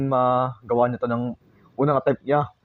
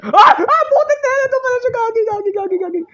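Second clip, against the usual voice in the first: very high, loud frightened swearing; panicked shrieks; terrified screaming; 9 dB louder than usual. Real, not acted. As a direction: shriek as it finds you. Jumpscare, monster found.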